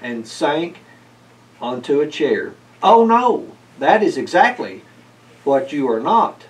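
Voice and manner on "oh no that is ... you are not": a higher, louder scold. A narrator's reading. Old man scolding the cat about the flooded kitchen, stern. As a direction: tell the cat off firmly. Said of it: "Festus scolded".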